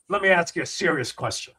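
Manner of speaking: imitating a straight-faced interviewer; understated retold deadpan. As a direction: ask it with mock seriousness. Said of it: "Ricky looks deadpan at".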